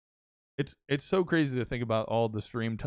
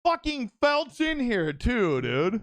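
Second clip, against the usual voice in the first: high, loud and slow, real delight; excited greeting; 9 semitones higher, 4 dB louder, slower than usual. Real, not acted. Streamer spotting his old friend Felt in chat, excited. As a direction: call out happily to a friend who just showed up.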